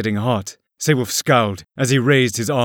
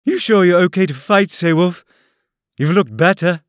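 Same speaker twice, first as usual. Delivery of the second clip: higher and louder, sugary taunt; sweet mockery; 8 semitones higher, 5 dB louder than usual. A narrator's reading. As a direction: sweetly needle your foe. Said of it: "Neil smiled sweetly".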